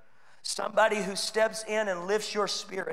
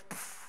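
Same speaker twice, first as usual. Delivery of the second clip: unvoiced pff; 17 dB quieter than usual. Switch sound effect.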